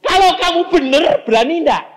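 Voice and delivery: high, loud challenge thrown at the sinner; shouted challenge. Acted. Preacher shouting a dare at the congregation, loud and high. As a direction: dare them at full voice.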